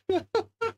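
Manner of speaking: very high squeaky burst of laughter; a helpless squealing giggle. Real, not acted. Streamer laughing at camera mess, high squeaky giggle.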